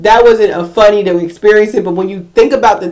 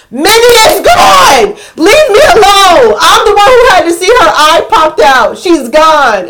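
exasperated shout; 8 semitones higher, 5 dB louder than usual. Retold or imitated. Owner shouting at the nephew who asks where Minnie is. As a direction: snap back at them to be left alone. Yelling at kids asking about dog.

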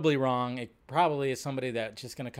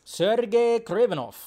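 high, slow mock Russian accent; attempted Russian accent; 7 semitones higher than usual. Streamer wondering how Kraven will sound, trying a Russian accent. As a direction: draw the name out in put-on Russian. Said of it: "gotta work on my Russian accent".

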